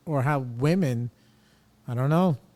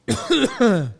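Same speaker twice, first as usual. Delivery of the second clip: sharp high loud coughing; real choking cough; coughing fit; 10 semitones higher, 7 dB louder than usual. Real, not acted. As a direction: cough hard, caught off guard. Chokes mid-sentence.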